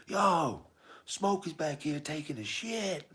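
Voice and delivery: high imitated holler, drawn out; mock yell in a very high voice; retold top-of-lungs shout. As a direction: call it out high and long. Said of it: "he shouts at the top of his lungs".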